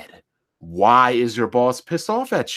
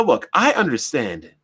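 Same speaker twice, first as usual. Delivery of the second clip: ordinary delivery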